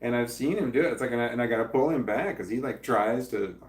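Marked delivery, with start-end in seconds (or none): none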